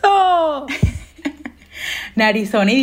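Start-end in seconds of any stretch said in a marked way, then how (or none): 0.0-0.7 a loud, high shriek of delight; excited shriek. Real, not acted. Hyping up her own selfie to friends, a loud happy shriek. 0.7-1.5 a short breathy laugh; airy laugh. Real, not acted. Laughing along with friends, a short airy laugh.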